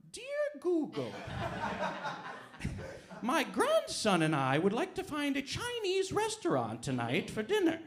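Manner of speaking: put-on a sweet old grandma politely asking a search engine. Grandma typing a polite question to Google, high old-lady voice. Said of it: "my grandma was like".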